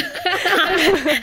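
high, loud burst of laughter; nervous-sounding laugh. Real, not acted. British guest asked about disliking Americans, laughing loudly. Asked to react to awkward assumption.

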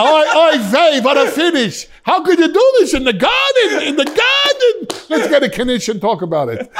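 high, loud hammed-up Yiddish accent; Yiddish caricature. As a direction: kvetch excitedly in a squeaky mock-Yiddish voice.

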